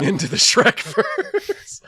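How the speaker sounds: hammed-up movie title, stretched out slowly; mock title announcement. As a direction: announce it grandly through laughter.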